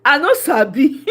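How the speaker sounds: laughing put-on British accent; a hammed-up British accent; mock British accent. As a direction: try a British accent badly while laughing. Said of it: "I know Sabi do that British accent".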